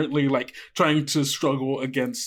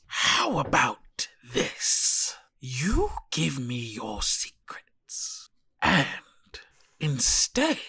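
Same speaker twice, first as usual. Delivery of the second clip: slow, whispery creature voice; sinister spider mother; slower than usual. Acted. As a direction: draw out each phrase in a menacing whisper. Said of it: "She looks, uh, down at you and says".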